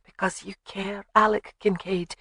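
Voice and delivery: soft Scottish accent, imitated; Scottish burr. A narrator's reading. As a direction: mimic a Scottish burr gently. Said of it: "imitated his burr perfectly".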